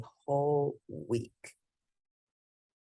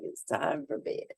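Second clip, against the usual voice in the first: soft breathy whisper of the mom's words; whispered mom line; 6 dB quieter than usual. Retold or imitated. Narrator whispers the mother's bedtime order aside. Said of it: "But his mother's like".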